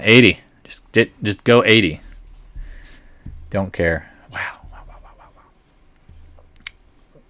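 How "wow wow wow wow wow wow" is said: stunned whispered wows. Real, not acted. Stunned at the earnings numbers.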